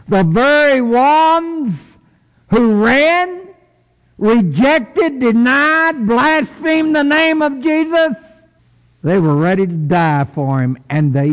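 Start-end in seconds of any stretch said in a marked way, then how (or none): 0.1-8.2 much higher, slow, building fervor; fervent preaching. Real, not acted. Preacher listing the disciples' failures, high and fervent. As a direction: hammer each word up high.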